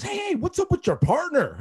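high-pitched indignant demand; worked-up retold challenge; fired up. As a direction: imitate a coach calling out a complaint. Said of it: "he's still fired up".

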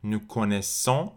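"connaissons" with a nasal final -ons; nasal ending. Shown as a demonstration. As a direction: say "Nous connaissons" slowly, ending on a nasal vowel. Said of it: "آخرش هم نزله".

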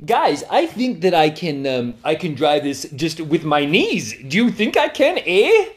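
put-on high, goofy Canadian accent. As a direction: play the reckless Canadian friend. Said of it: "he's like".

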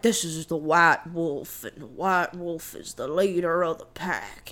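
imitated Southern speaker, pitched well down; John Goodman. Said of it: "John Goodman's like".

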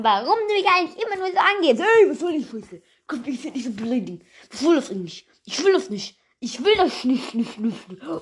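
half laughing, whining protest; Hannes's voice. Acted. Character argues with Hannes, lightly put-on whiny voice. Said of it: "von dieser Stimme von Hannes".

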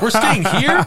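high, loud incredulous question; teen seeing the bamboo house, laughing in disbelief; shocked disbelief. Retold or imitated. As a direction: blurt it out like you can't believe it. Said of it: "And so I'm like".

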